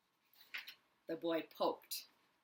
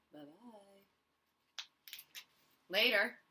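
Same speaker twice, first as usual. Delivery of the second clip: ordinary delivery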